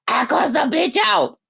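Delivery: high, hoarse shouted boast in mimicry; croaky, high-pitched mock bragging; dry-throated mocking. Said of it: "listen to this dry throat, ashy throat bitch".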